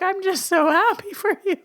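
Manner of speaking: high, squeaky imitation of happy crying; mock tearful joy. Retelling her congratulations to her engaged cousin, mock-weepy high voice. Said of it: "I was crying".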